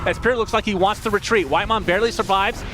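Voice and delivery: excited esports fight call; urgent running match commentary; live play-by-play. Real, not acted. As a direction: drive the commentary as the fight builds.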